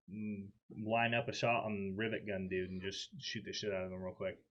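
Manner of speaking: usual speaking voice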